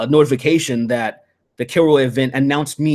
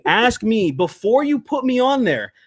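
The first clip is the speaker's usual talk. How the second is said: higher, slow and drawn out, real frustration; slow angry insistence; 8 semitones higher, slower than usual. Real, not acted. Venting to his friend about event organizers, slow and angry. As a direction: lean on each word, fed up.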